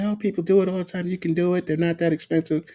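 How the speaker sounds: high, fast mock ex-wife voice; high mocking imitation of the ex-wife. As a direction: parrot her breezy reassurance. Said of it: "my fucking ex-wife used to say that shit".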